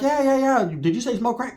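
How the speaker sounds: high, excited imitation; mock eager women. Chicks all into the offer, high eager imitation. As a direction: pitch it up as keen party girls.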